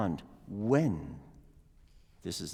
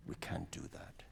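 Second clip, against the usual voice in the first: whisper; 5 semitones lower, 13 dB quieter than usual. Real, not acted. Teacher whispers a correction.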